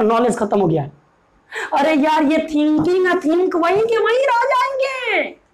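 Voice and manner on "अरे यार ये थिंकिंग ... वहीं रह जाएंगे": high-pitched exasperated cry, last words drawn out; wailing "oh come on" protest; exasperated outburst. Real, not acted. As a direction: let the pitch soar in protest and stretch the ending. Exasperated outburst while teaching.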